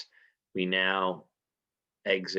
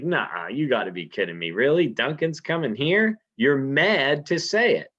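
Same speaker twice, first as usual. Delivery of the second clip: higher and louder, disbelieving; you can't believe it's true; incredulous; 5 semitones higher, 4 dB louder than usual. Retold or imitated. Lady Macbeth hearing Duncan is coming, incredulous.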